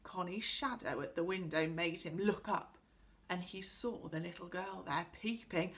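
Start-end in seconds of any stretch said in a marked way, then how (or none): none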